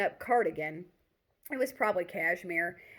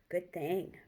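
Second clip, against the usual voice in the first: a softer, mock-grave echo; mock serious; 5 dB quieter than usual. Lily agrees with him in a quiet, mock-serious voice. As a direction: pretend to be solemn about it. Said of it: "mock serious".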